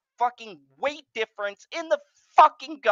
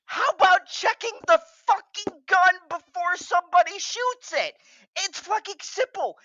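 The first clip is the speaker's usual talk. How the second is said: pitched-up exasperated tirade; exasperated rant; 9 semitones higher than usual. Real, not acted. Ranting co-host furious at the gun handlers, exasperated. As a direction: hammer the obvious point home.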